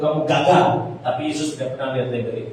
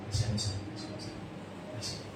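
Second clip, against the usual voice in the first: fast, breathless whisper of Kasihani saya; whispered plea; 4 semitones lower, 15 dB quieter, much faster than usual. Acted. Beggar's plea, hushed.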